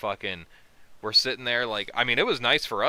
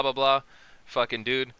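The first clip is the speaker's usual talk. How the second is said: ordinary talk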